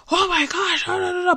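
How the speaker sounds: high-pitched mock fussing; retold anger. Owner rants at dog over mattress, mock anger. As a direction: imitate your own angry ranting, voice up high. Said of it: "I was so angry with him".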